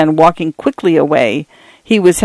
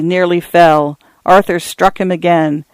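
usual speaking voice

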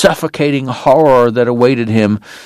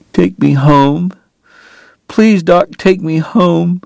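begging; 6 semitones higher, slower than usual. Acted. Kate begging Doc.

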